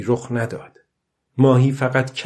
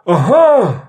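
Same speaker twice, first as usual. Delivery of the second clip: high, loud triumphant cry; loud exclamation; 9 semitones higher, 7 dB louder, much slower than usual. A narrator's reading. Old man alone at sea as he hauls the line, shouting out. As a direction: call it out loud, pitch leaping up. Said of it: "پیرمرد با صدای بلند گفت".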